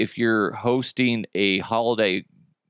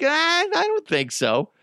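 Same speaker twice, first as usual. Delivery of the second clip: mock reply. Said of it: "I'm like".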